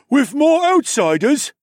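shocked, very high, loud cry; aghast at the idea; gasped. Acted. Unseen lizard gasping at the news, shocked high cry. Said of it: "someone I didn't see gasped".

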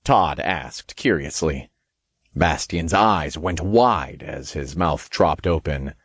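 ordinary delivery

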